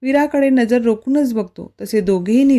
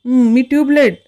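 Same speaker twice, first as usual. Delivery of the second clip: louder, higher indignant retort; mock offended; 4 semitones higher, 6 dB louder than usual. Wife called a tubelight by her husband, mock offended. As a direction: snap back in playful offense. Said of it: "ती चिडूनच बोलते".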